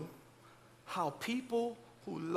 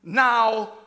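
ordinary delivery

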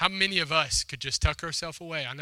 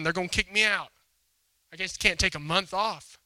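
panicked pleading; 4 semitones higher, 6 dB louder than usual. Retold or imitated. Nun panics at God's request.

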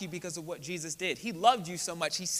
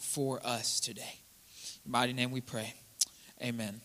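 soft, earnest opening prayer; 4 semitones lower, 4 dB quieter than usual. Real, not acted. Pastor praying over the church, soft and earnest. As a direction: pray it low and gentle.

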